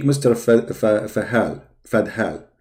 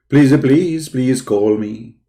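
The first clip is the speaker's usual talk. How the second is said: slow, hammed-up pleading on the last please; mock begging; slower than usual. Streamer mock-pleading with the Dawah team, slow begging. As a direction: beg theatrically, not meaning it.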